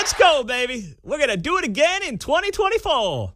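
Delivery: high, hammed-up Detroit rally voice; mock Detroit accent. Host parodying Harris at the union rally, high mock Detroit accent. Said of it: "urban accent".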